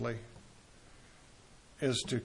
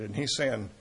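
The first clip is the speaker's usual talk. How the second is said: normal talking voice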